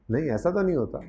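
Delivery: normal talking voice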